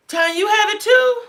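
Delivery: very high-pitched surprised question. Real, not acted. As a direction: react to unexpected news, voice leaping up. Surprised by a viewer's news.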